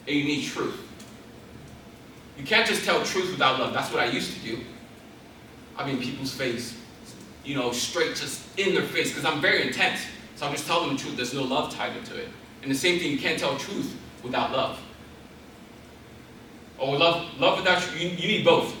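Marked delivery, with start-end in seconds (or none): none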